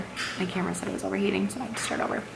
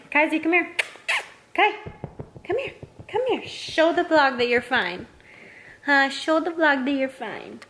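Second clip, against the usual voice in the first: high-pitched calling to a dog; coaxing pet talk; 7 semitones higher than usual. Real, not acted. Owner calling the dog to show the vlog, coaxing pet talk. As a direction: coax the dog over in a high voice. Calls dog over to the camera.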